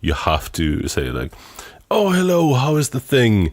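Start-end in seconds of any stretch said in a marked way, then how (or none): 1.9-3.5 high, loud, over-bright mock greeting; chirpy greeting. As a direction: parody a forced cheery conversation opener. Mocking a forced Dutch conversation opener.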